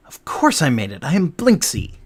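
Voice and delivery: indignant toy maker; emphatic, affronted declaration; offended. Acted. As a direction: bristle with wounded pride at being doubted. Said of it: "He looks offended at that".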